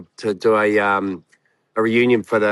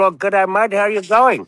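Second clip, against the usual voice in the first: lightly hammed-up Aussie-style greeting, pitched higher; higher, mildly exaggerated Australasian accent; Australasian accent impression; 6 semitones higher than usual. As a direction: put on a cheery mock Australasian accent. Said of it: "if you can do our accent".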